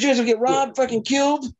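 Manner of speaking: high-pitched retold scolding; pissed-off warning. Angry man warning careless tourists in Mexico, imitated scolding. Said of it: "He was so pissed off at us".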